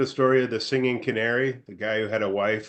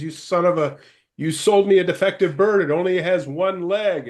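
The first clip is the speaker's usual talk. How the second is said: angry complaint; 9 semitones higher, 4 dB louder than usual. Retold or imitated. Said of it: "He calls the pet store. He says".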